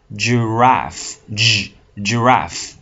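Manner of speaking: Giraffe broken as Gi- then giraffe, slowly; slow pronunciation drill. Shown as a demonstration. Teacher models giraffe slowly for learners, syllable by syllable. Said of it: "teacher drills pronunciation".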